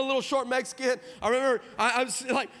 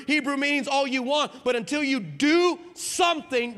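forceful, raised preacher's delivery; impassioned preaching; 4 semitones higher, 4 dB louder than usual. Real, not acted. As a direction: drive each phrase home to the congregation. Preaches the point at full force.

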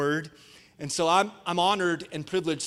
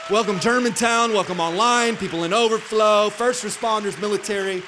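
loud, higher hype-man welcome; shouted welcome; 4 semitones higher, 7 dB louder than usual. Real, not acted. Pastor shouting welcomes to online and overflow crowds, rousing shout. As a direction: call each group out over the applause. Calling out welcomes over applause.

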